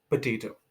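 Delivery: पोटैटो as puh-TAY-toh, weak first vowel; potato, schwa at the start; correct potato. Shown as a demonstration. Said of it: "इसको पोटैटो बोलना".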